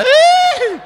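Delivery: very high, loud squeal of disgust. Acted. Gossipy friend squealing at the ugly husband, high squeal. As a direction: squeal like it's gross.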